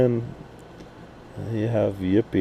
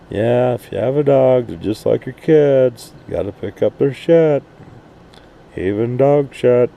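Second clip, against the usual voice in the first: usual speaking voice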